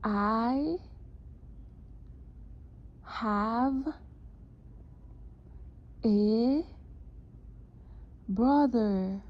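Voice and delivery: one word at a time, clearly; dictating. Shown as a demonstration. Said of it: "This dictation".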